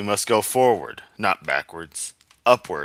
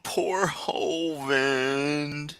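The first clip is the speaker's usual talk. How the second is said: very slow, higher sarcastic sympathy; mock pity; 6 semitones higher, much slower than usual. Mocking the preacher with very slow fake sympathy. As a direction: drag it out with fake sorrow.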